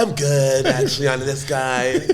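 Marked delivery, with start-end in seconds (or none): none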